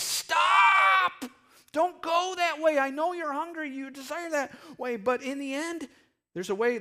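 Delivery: high, urgent shout, easing in volume; yelled warning. Acted. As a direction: cry out to halt someone in danger. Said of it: "we as believers are yelling at times".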